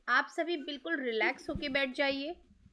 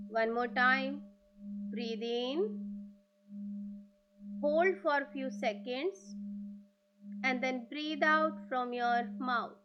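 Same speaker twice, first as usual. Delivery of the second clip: very slow, drawn-out breathing cues; guided breathing meditation; much slower than usual. Acted. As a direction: guide them through each breath, stretching the words. (धीमी, लंबी खिंची ध्यान की आवाज़)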